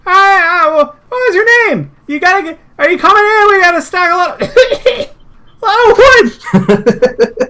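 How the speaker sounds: high frantic yelling voice, cracking into laughter; panicked NPC. Acted. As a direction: squeal it out like a flustered worker. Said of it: "So he's like".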